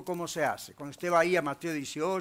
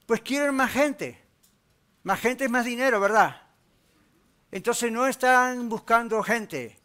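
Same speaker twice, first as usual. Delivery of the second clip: louder, higher, emphatic pulpit delivery; forceful preaching; 4 semitones higher, 6 dB louder than usual. Real, not acted. Pastor warning his church against chasing money and clients, forceful preaching. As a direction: drive each phrase home to the congregation.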